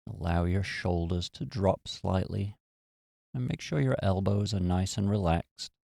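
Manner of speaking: calm, unhurried meditation voice with long pauses; guided meditation. Real, not acted. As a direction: gently guide them into relaxation, leaving space after each phrase.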